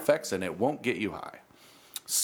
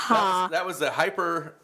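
laughing; 4 semitones higher, slower than usual. Real, not acted. Laughing at the promo name.

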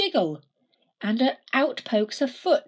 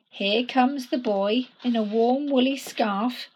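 ordinary delivery

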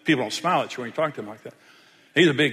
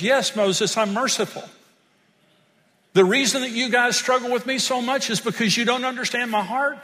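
higher, louder preaching voice; preaching at full force; 7 semitones higher, 3 dB louder than usual. Acted. Pastor preaching to congregation, voicing God's words with fervor.

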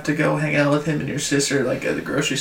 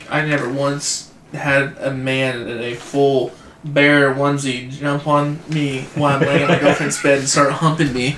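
ordinary talk

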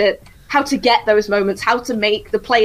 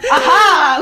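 villain cackle; 11 semitones higher, 3 dB louder than usual. Pretend. Voicing a puppet count villain.

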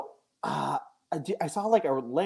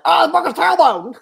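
high loud mock yell; imitating Jared; 13 semitones higher, 11 dB louder than usual. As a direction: squeal it like a hurt wrestler. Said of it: "I just remember one of Jared".

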